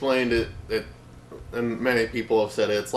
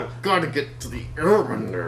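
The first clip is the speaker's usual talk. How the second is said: through gritted teeth; 6 semitones higher than usual. Retold or imitated. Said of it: "talks all his lines through grit teeth".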